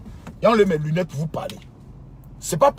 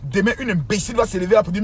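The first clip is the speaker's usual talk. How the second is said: normal talking voice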